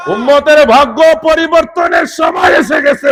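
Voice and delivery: high, loud preacher's shout; climactic proclamation. Retold or imitated. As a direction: burst out with the announcement at full voice. (উচ্চকণ্ঠে চূড়ান্ত ঘোষণা)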